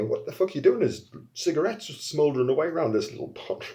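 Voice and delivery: baffled onlookers. Retold or imitated. Onlookers' baffled question retold.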